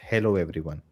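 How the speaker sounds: normal talking voice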